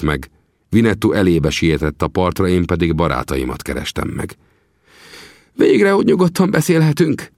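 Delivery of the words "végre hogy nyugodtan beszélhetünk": put-on much higher, louder relieved outburst of the old trapper; eager exclamation. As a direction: burst out with relief. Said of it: "kiáltott fel Sam".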